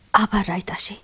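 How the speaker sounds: ordinary delivery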